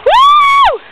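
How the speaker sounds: very high, loud whoop; excited cheer. Real, not acted. Cheering him on.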